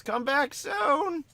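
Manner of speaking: put-on very high, slow sweet little boy; sing-song farewell from a small kid; eight-year-old boy. Shop boy bidding the customer goodbye, very high and slow. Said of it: "The eight-year-old".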